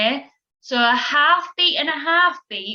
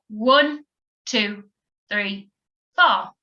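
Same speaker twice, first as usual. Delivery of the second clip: count-in; 2 semitones lower, slower than usual. Shown as a demonstration. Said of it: "do this one now".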